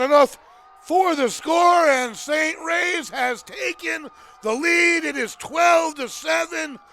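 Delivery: emphatic score call turning into a pep speech; loud, dramatic football announcer; rousing sports commentary. Real, not acted. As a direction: punch each phrase to rally the team. Announcer calls score, exhorts team.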